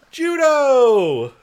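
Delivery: high, loud excited cheer. Real, not acted. Co-host cheering George's judo throw, loud excited whoop. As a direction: whoop it like a fan.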